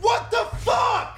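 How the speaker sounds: very high, long-stretched yell; fire yeller's scream. Acted. Fire yeller arriving at a disaster, screaming. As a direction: drag every word out in a comic shriek. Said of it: "the fire yeller shows up and he goes".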